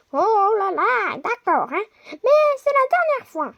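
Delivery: high prisoner voice. Acted. Prisoner grumbling to the guard, high and reluctant. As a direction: whine it like a sulky prisoner.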